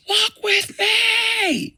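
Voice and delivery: very high, drawn-out yell; desperate shout. Retold or imitated. Lucifer shouting to Michael before being blasted out, screaming. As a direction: scream it out, stretching every word. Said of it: "and then Lucifer shouts".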